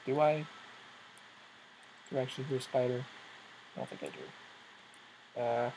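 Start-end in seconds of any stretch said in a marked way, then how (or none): 3.7-5.4 whisper. Real, not acted. Listening for a spider sound.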